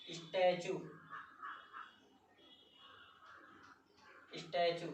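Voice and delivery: teacher models स्टैचू as sta-choo; model pronunciation. Shown as a demonstration. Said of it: "और आगे देख लेते हैं".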